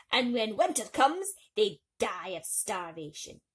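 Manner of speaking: put-on loud, scornful old mole. A narrator's reading. The mole scoffs at the swallow on the way, loud and scornful. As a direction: sneer at the dead bird.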